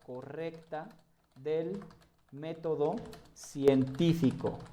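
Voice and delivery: slow, spaced-out words with long pauses; dictating while typing. Shown as a demonstration. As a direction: say it word by word as you type. Dictating to self while typing answer.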